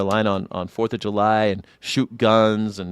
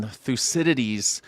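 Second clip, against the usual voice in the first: usual speaking voice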